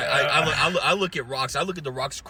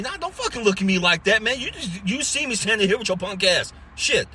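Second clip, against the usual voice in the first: retold angry retort; 6 semitones higher than usual. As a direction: snap back at the rude clerk, half-acted. Retold confrontation with ignoring worker.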